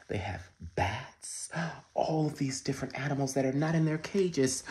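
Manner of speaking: usual speaking voice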